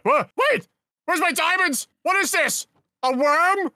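high panicked shouting; frantic search for lost diamonds; freaking out. Acted. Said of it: "he's freaking out".

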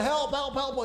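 high mock yell for help. Passengers yelling help into the radio, panicked. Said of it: "start yelling".

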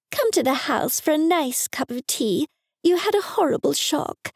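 a hint of a high fussy mum; fussing mum. A narrator's reading. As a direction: coax him in like a clucking mum. Mum voiced fussing over Ian.

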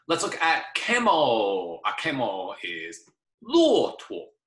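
normal talking voice

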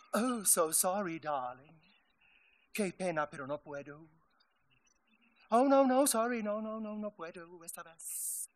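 soft, lightly voiced mock of a fake-apologetic lady; a hint of a phony sweet socialite; mocking imitation. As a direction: sneer while parroting her excuses, softly. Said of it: "era siempre la misma respuesta".

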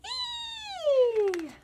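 very high gleeful squeal; a high happy yelp; excited squeal. Acted. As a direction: squeal with delight. Potato cheers.

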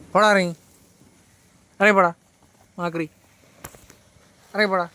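real annoyed shout at monkeys; shooing monkeys. Real, not acted. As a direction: bark at the monkeys to get down.

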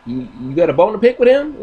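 quick chattering defence; squirrel. Retold or imitated. Squirrel defending his friend, fast and high. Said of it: "And I was like".

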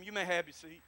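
ordinary talk

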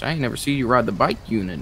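usual speaking voice

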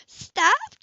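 high, loud sharp cry; startled shout. A narrator's reading. Woman nuzzled at the wheel, shouting in alarm. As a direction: cry out to stop him. He nuzzles her, she protests.

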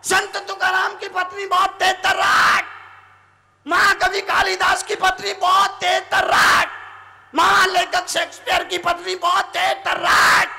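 high, forceful roll call; loud proclamation. Shown as a demonstration. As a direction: call each one out to the crowd. Preacher proclaims list of wives.

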